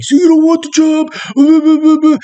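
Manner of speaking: high, slow, put-on drill-instructor yell; mock screaming. The agency chief screaming at the captive, in a high mock yell. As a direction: imitate a chief screaming in his face. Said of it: "getting in my face and screaming at me".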